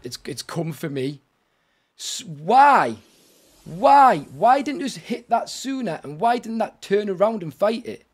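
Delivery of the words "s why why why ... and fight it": exasperated. Real, not acted. Killed by creature, exasperated.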